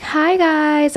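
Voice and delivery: a light imitation of a sugary pop-star hello. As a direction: chirp it like a sweet teen pop idol. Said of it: "I am getting some [name]".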